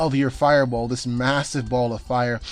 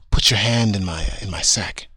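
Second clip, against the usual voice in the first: creepy Santa; 5 semitones lower than usual. Acted. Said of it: "He's gonna say".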